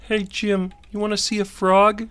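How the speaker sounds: high-pitched cartoon kid voice; Carl. Carl showing Jimmy his frog drawing in class, high character voice. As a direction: pitch it up like an eager sidekick. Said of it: "And Carl says".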